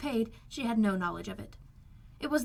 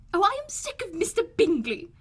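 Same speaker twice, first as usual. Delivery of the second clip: high, louder fed-up outburst; exasperated cry; 10 semitones higher, 7 dB louder than usual. A narrator's reading. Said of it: "cried his wife".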